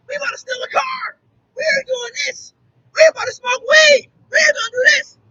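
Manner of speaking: put-on high, shouted kids' voices with a whiny, near-crying edge. Kids shouting at the mentor about trouble, high and whiny. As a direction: play the kids bragging they'll make trouble.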